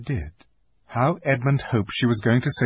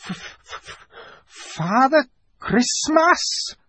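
breathless f sounds, then a slow high name; frightened fox stammering; terrified stammer; slower than usual. Acted. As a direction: stutter out the answer in fear. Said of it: "stammered the fox".

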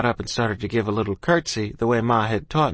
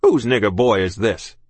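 stern captain; 6 dB louder than usual. Acted. Captain's held voice.

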